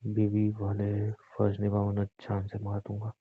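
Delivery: slow, soft and threatening. Acted. Husband coldly ordering his wife to do her duty, menacing. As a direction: say it like a quiet threat.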